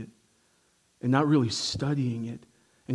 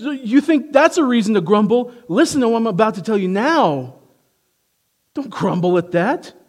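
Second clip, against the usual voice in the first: louder, higher forceful challenge; doubling down; 7 semitones higher, 6 dB louder than usual. Retold or imitated. Jesus doubling down on grumblers, loud and forceful. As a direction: press harder on the crowd.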